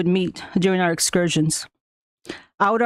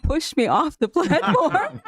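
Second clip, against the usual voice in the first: laughing through it, high and slow; words spilling out in a laugh; 8 semitones higher, slower than usual. Real, not acted. Telling the school board about the jump, laughing. Laughing while recalling the push.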